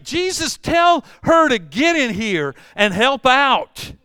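high, loud, slow exasperated shout; Martha's complaint. Retold or imitated. Martha calling to Jesus to make Mary help, exasperated shout. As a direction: yell at Jesus in frustration. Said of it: "she's like".

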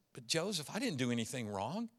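tender broken plea; hushed pleading. Acted. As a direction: beg him to believe her. Mary pleading.

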